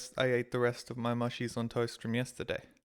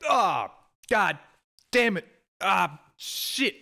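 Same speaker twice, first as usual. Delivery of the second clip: higher, louder fed-up curse; frustrated cursing; 8 semitones higher, 6 dB louder, much slower than usual. Retold or imitated. Streamer retelling finding mold, loud frustrated curse. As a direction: swear out loud at the ruined bread. Realizing the bread is moldy.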